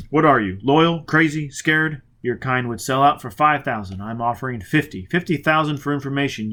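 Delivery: loud, threatening bark; menacing demand. Acted. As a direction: threaten him hard. Foyle menacing, offering money.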